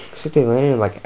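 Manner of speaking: ordinary talk, nothing marked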